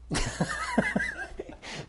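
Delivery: high burst of real laughter; sudden high laugh, amused at himself; big laugh. Real, not acted. Laughing at his own shallow sin.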